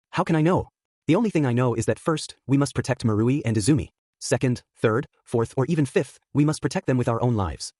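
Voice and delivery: ordinary delivery, nothing marked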